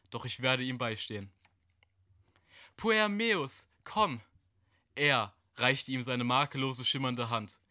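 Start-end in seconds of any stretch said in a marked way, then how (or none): 2.8-4.2 hohe, lockende Figurenstimme; verführerische Gestalt. Acted. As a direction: ruf ihn mit hoher, fremder Stimme zu dir. (hohe, lockende Stimme einer dunklen Gestalt)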